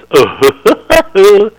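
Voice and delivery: loud, very high dopey uh-huh grunt; hammed-up slow-witted uh-huh. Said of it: "uh-huh was added by me".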